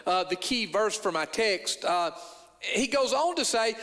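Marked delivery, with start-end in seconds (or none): none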